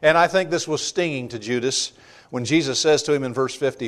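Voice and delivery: usual speaking voice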